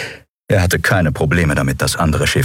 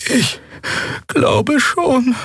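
high, halting character voice; nervous crew member; much slower than usual. A narrator's reading. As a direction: answer hesitantly in a much higher voice. (nervöse, hohe, zögernde Figurenstimme)

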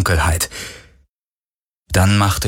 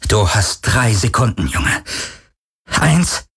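mildly quavering ultimatum; threatening but unnerved; trembling threat; 2 semitones higher, slower than usual. A narrator's reading. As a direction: count off with a nervous tremble. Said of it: "Parkers Stimme bebte".

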